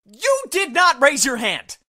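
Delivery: scolding shout. Acted. Teacher scolds student for interrupting.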